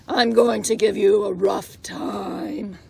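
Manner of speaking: low, rough shouted threat; gruff rhino voice. Acted. The rhinoceros threatening the little porcupine, low and gruff. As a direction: play the big bully rhino. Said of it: "said the rhinoceros".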